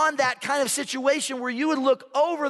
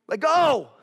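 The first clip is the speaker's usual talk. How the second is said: voice from above; 4 semitones higher, 4 dB louder than usual. Acted. Acted voice from heaven.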